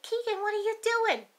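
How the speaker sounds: put-on asking a child what she's up to; mock bewildered call; puzzled call. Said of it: "And we're like".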